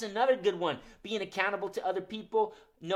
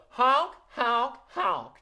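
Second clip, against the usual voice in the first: loud, sassy goose honks; 9 semitones higher, 8 dB louder, much slower than usual. Shown as a demonstration. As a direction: honk like a cheeky goose. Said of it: "What about a sassy goose?".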